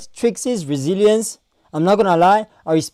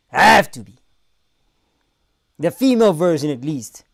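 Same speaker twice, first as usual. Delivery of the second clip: ordinary talk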